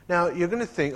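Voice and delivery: ordinary talk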